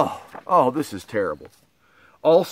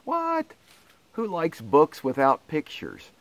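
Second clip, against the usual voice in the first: mock shock. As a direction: yelp it out in fake disbelief. Reader shocked by book title.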